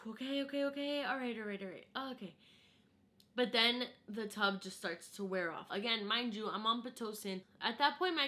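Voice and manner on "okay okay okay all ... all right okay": sighing self-talk through pain; breathing through a contraction. Retold or imitated. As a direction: coach yourself through the wave. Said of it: "I'm like".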